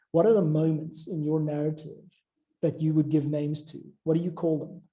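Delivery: usual speaking voice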